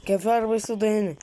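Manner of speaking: ordinary talk, nothing marked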